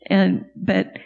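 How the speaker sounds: usual speaking voice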